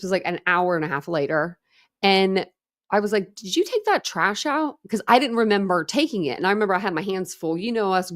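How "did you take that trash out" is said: alarmed question. Retold or imitated. Panicked question to husband in car.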